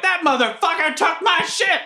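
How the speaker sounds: high, furious yell over the crowd; outraged yell. Acted. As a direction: yell it in outrage, unheard in the noise. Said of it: "I'm like".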